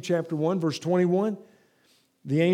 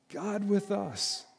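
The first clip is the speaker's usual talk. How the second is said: slow and softer, letting it land; hushed awe; 3 semitones higher, 6 dB quieter, much slower than usual. Acted. Preacher to congregation, hushed and slow. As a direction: breathe the words out slowly in wonder.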